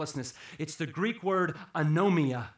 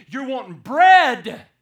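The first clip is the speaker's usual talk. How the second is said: much higher and louder, fiery revival preaching; preaching at full force; 10 semitones higher, 10 dB louder than usual. Acted. Preacher thunders at the church about making Jesus Lord. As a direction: thunder it at the congregation.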